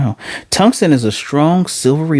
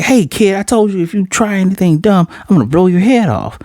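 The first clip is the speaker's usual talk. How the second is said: higher, pushed mock threat; threatening hijacker; 9 semitones higher than usual. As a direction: menace the kid, hammed up a bit. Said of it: "the guy's like".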